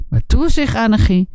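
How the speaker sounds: usual speaking voice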